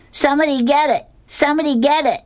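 very high-pitched calling out; mock yell. A narrator's reading. Boy on the pitcher's mound to his team, mock yell. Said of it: "he yells out".

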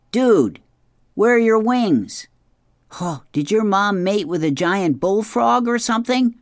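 high, loud incredulous dragon; comic dragon jeering in disbelief; mocking dragon. Voices the dragon.

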